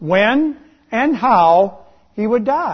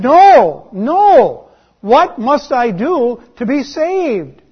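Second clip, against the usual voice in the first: louder, higher desperate begging; frightened plea; 5 semitones higher, 5 dB louder, slower than usual. Retold or imitated. Frightened man begging the pastor for salvation, loud desperate plea. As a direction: cry out in panic for help. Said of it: "he really got frightened".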